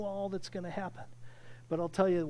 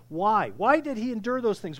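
usual speaking voice

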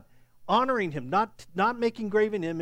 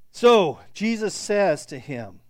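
ordinary delivery

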